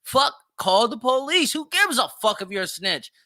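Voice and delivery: normal talking voice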